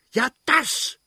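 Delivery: very high, furious outburst; angry shout. Acted. The prince yells back at his wife, furious shout. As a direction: snap it out in fury. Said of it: "schrie der Fürst zornig".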